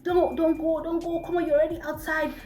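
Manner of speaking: begging. Retold or imitated. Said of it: "He kept begging".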